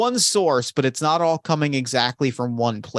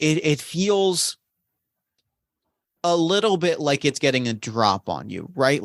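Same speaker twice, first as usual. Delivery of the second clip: ordinary delivery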